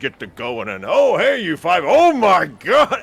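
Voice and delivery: a fussy host who suddenly spots the party; Ruffin's voice. Acted. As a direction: innkeeper startled by five bloodied travellers. Innkeeper Ruffin chats then startles.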